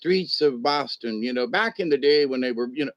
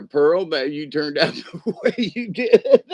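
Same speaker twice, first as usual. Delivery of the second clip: laughing while teasing. Real, not acted. Teasing Pearl, laughter heard.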